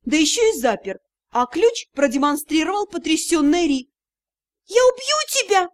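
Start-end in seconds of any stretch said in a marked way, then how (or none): 4.7-5.7 high furious scream; furious roar. A narrator's reading. Tricked elf girl screaming at her betrayer in fury. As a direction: scream the threat at him. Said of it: "взревела эльфийка".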